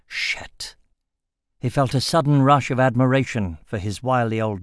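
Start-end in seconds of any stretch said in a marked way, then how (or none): 0.1-0.8 breathless whispered curse; silently mouthed. Acted. Jarek caught faking sleep, mouthing a whispered curse. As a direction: barely mouth the word. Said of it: "his silently mouthed".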